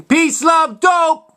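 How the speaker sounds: quoted movie shout, pitched high; high, punchy imitation of shouting; mock shout. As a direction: call it out like the film's outburst. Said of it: "James Earl Jones shouting".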